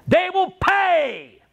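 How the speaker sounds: WILL stretched out high and slow; stress on WILL. Acted. Speaker mocking rich buyers, drawn-out high emphasis. Emphatic repeat of they pay.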